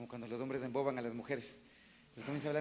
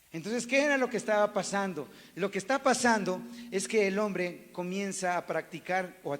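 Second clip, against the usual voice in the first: loud, rising sermon voice; preaching at full force; 4 semitones higher, 6 dB louder than usual. Acted. Pastor preaching loudly to the congregation about infidelity. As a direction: drive the point home to the congregation.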